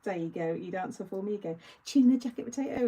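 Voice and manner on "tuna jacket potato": imitating a Turkish waiter's accent, louder, on "Tuna jacket potato"; "Tuna jacket potato" called out in a put-on Turkish accent; Turkish twang. The friendly hotel waiter answering her order in a Turkish accent. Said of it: "in, like, his Tur-, Turkish twang".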